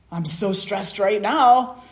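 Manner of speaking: loud, slow, exasperated groan of words; the fed-up king, loud and slow with exasperation. Acted. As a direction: let the stress burst out. King venting his stress.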